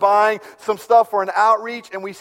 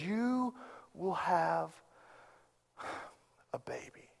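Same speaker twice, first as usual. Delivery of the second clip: soft, unvoiced whisper of a promise; hushed; 17 dB quieter, slower than usual. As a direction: drop to a near whisper, voice fading away.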